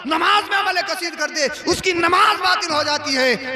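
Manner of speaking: high, driving, emphatic harangue; full-force preaching. Real, not acted. Preacher arguing against opponents about prayer rules, full-force preaching. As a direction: pile up each case for the crowd.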